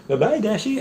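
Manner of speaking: high cutesy voice to a pet; pet talk. Acted. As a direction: coo at the cat, high up. Talking to the cat Dashi.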